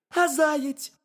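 high, squeaky little hedgehog; very high, loud cartoon critter voice. A narrator's reading. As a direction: pipe it up as a tiny curious hedgehog. Hedgehog keeps questioning the bear.

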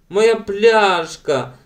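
slow, high, loud wail. A narrator's reading. Ellen mourns her broken bottle, wailing. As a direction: drag the wail out in grief. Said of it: "завила".

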